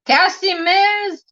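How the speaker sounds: high, drawn-out name call; calling out a greeting. Real, not acted. Calls out greeting to arriving viewer.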